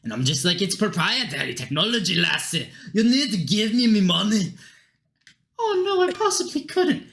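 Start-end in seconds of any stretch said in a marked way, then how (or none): 0.6-4.5 insistent Scottish brogue; Scottish accent. Acted. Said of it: "Don't know why he's Scottish". 5.6-7.0 very high mocking falsetto; mock goddess voice. Mimics the goddess's refusal.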